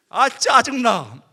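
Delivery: put-on the exasperated teen who can't find the exit, slow and loud; slow loud irritated outburst; loud annoyed groan. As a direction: drag out a loud, fed-up 짜증나, slowly. Annoyed in the dark hall.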